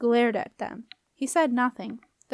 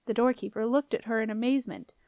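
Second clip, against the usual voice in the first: ordinary delivery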